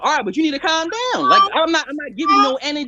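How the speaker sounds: high-pitched, heated insistence; worked-up protest; agitated arguing. Real, not acted. Heated argument, talking over each other.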